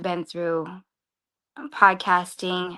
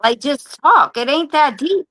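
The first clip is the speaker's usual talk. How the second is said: louder, higher impression of a friend; brushing it off; 6 semitones higher, 9 dB louder than usual. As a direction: wave her worries away. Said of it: "He's like".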